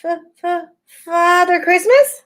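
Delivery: high, slow, frightened stammer; high stammering fox. A narrator's reading. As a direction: stutter it out in terror. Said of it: "stammered the fox".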